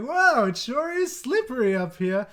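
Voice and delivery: hammed-up cheery Ned impression; Ned Flanders voice. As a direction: Homer still faking Ned on the roof. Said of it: "does, like, the Ned Flanders voice".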